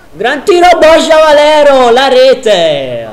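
high, loud goal call; breathless italian goal commentary. Real, not acted. Goal scored, live call. (telecronaca del gol urlata)

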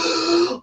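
sharp mock gasp of shock. Kids rising up against the son-in-law, a sharp gasp. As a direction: gasp as if stung by the remark. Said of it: "kind of went".

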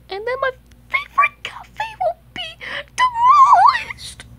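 high, whispery frightened cry; trembling high whisper of dread; scared whimper. Acted. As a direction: fret tearfully about the cafe. Fearing the cafe's destruction.

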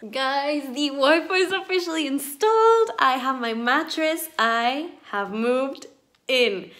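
high, loud announcement; excited. Real, not acted. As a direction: announce it proudly. Triumphant moving-in announcement.

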